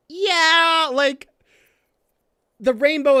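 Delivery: squealed high, loud yeah; gushing over a favourite panel; excited yeah. Real, not acted. Fan gushing to viewers over a panel, squealed.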